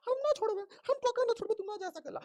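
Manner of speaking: high, squeaky dialect plea of a crying wife; wife's pleading voice. Acted. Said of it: "ऐसे ये कह रही है". (ऊँची, पतली आवाज़ में रोती पत्नी की मिन्नत)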